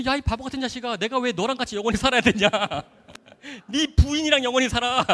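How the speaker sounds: high, loud, hammed-up outburst; retold scolding. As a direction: yell a comic, exasperated telling-off at a friend. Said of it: "막 걔네 막 뭐라 했어요".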